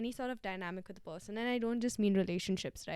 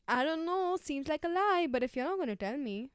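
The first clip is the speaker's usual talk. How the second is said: higher and louder, fed-up disbelief; exasperated retort; 6 semitones higher, 7 dB louder than usual. Retold or imitated. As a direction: call out the lie, exasperated. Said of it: "I'm like".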